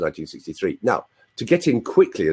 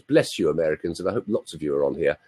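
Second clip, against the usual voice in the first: normal talking voice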